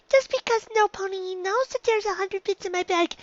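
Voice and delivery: put-on high young filly voice, worrying; a nervous little unicorn fretting to herself; high filly voice, anxious and rambling. Dinky frets about her bits.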